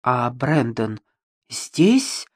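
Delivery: hesitant, broken by a pause, rising sharply; wary question. A narrator's reading. Bride asking after the groom, warily. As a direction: ask cautiously, lifting the last word. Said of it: "с опаской спросила Ребекка".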